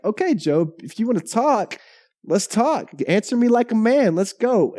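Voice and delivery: high-pitched, acted-out challenge; mock squaring up, voice pitched well up; confrontational challenge. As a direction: call them out for a showdown. Voicing God challenging Job to talk.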